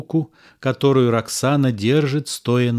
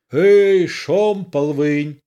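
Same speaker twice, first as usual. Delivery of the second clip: loud barked order, higher; battle command; 4 semitones higher, 4 dB louder, slower than usual. A narrator's reading. Carbon shouting an order to his guards offstage. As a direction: bark the command to troops.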